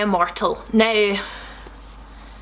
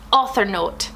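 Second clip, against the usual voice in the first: normal talking voice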